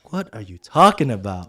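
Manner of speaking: loud, incredulous retort; baffled exasperation. Retold or imitated. Young supervisor not getting his mentor's point, exasperated. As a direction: act out being totally lost, loudly.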